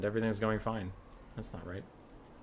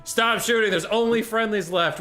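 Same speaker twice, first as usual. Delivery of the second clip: calling ceasefire; 10 semitones higher, 7 dB louder than usual. Pretend.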